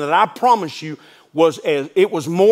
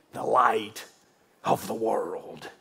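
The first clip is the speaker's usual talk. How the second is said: fading to hush; 9 semitones lower, 10 dB quieter, much slower than usual. Acted. Preacher fades to hush.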